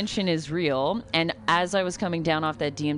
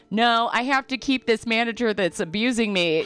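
stubborn whine; 6 semitones higher than usual. Retold or imitated. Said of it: "people were like".

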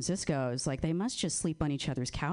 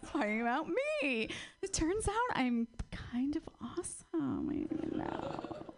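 breathy high whisper, confiding; whispered aside; 10 semitones higher, 4 dB quieter than usual. Acted. Comic confiding the crowd a secret, whispered. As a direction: let them in on a secret.

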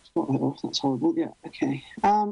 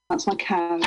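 usual speaking voice

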